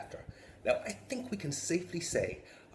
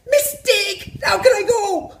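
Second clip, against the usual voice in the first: very high loud panicked cry; panicked child; 17 dB louder, slower than usual. Retold or imitated. Boy wetting himself, panicked shriek. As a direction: shriek in horror.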